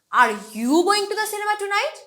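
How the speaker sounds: "you" punched high, the rest rising to a question; stress on YOU. Shown as a demonstration. Teacher asks if it is you going, stress on "you". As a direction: hit "you", as in you and not someone else. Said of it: "তার মানে আজকে কি তুমিই যাচ্ছ".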